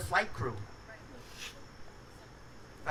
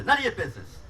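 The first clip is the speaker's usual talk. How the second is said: irritated snap; 7 semitones higher, 5 dB louder, faster than usual. Retold or imitated. Frustrated caller snaps at menu.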